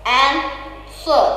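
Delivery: "Answer" split as AN, pause, SWER; syllable-by-syllable stress demo. Shown as a demonstration. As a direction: break "Answer" into two drawn-out syllables, AN first. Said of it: "Stress on the word an".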